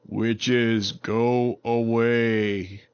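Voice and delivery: very slow, drawn-out booming warning; tree voice. Acted. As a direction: speak like an ancient living tree. Tree voice warns witches again.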